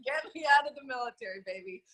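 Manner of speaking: fast, high, hammed-up husband voice; husband's plea. Husband begging his wife to retire him from the military, quick and high. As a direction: rattle it off like a hopeful husband.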